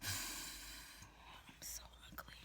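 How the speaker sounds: breathy slow whisper of self-disgust; whispered dismay. Real, not acted. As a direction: whisper it like you can't bear your reflection. Looking at herself on camera.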